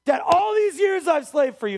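loud, high furious shout; angry son yelling at his father; screaming at dad. Acted. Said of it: "He's screaming at his dad".

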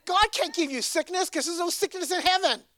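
high, loud preaching burst; fiery preaching. Acted. Pastor refutes sick Christians, preaching high and loud. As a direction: press the point hard.

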